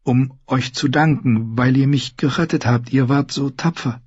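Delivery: put-on a shy young lady working up to a thank-you; hesitant Sansa. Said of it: "sagte sie zögerlich".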